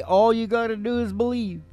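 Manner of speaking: usual speaking voice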